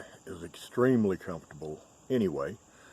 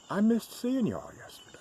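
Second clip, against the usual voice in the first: whispering. Real, not acted. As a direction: whisper it fondly to viewers. Whispered aside to viewers.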